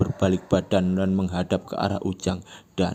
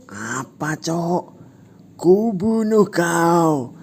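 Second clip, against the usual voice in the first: high, loud, very slow threat; menacing threat; 10 semitones higher, 6 dB louder, much slower than usual. Acted. Fat guy threatening Ujang, slow menacing. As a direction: drag out the kill threat.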